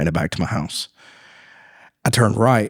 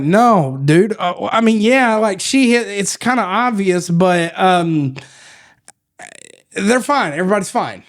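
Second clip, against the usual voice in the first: mock panicked little kid; flustered kid voice; 10 semitones higher than usual. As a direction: babble like a scared five-year-old. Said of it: "I immediately became, like, five years old".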